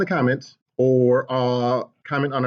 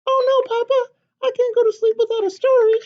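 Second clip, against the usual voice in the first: high, loud, eager chick's squeak; a tiny excited hen butting in; little chicken voice; 4 dB louder than usual. Acted. Chicken bursting into papa's story, high and eager.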